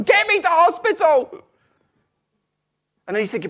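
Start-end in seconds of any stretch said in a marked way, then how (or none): 0.0-1.4 high, loud panicked yell; shouting. Acted. Wife in labor yelling at her husband, shouted. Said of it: "The wife is shouting".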